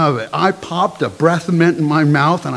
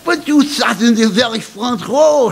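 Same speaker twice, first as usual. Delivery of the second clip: put-on higher-pitched French-accented insistence; persistent headmistress; 5 semitones higher than usual. Headmistress presses student about German class, persistent. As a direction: press the point in a high French voice. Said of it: "she persisted".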